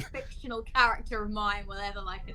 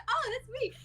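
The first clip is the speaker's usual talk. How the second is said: high, light imitation of delight; mock delighted recognition. Player voicing her character's usual moment of fame, mock delight. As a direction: pipe up as if thrilled to be noticed.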